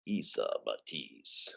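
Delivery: slow, dramatic whisper; hushed whisper. Acted. Host addressing a donor by name in a slow, hushed whisper. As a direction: whisper the name slowly, very low.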